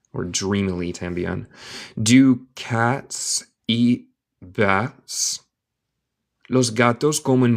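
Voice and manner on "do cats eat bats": slow, careful question with a rise; slow teaching read. Shown as a demonstration. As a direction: read it slowly for a learner. Slow model reading for learner.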